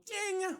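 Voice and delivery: a high mock ding of the defroster; a playful high beep; imitated timer ding. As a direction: chime it like a kitchen timer. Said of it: "I hear the defroster go".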